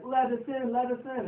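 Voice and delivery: high, bouncy pony call to be let in; lightly voiced cartoon pony calling out; chirpy Pinkie Pie call.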